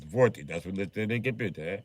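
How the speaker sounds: repeated in a stronger put-on Russian voice; lightly voiced Russian salesman insisting; mock Russian accent, deadpan. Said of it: "I don't even be looking".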